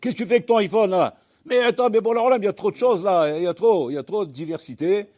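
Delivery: shouting over all the temptation; mock exasperated voice. Preacher voicing a tempted man, loud exasperation.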